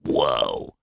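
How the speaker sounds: low, hushed, drawn-out reaction; awed whoa. Retold or imitated. Recalling seeing ballerinas as a kid, hushed awe. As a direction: breathe it out low and soft, awestruck.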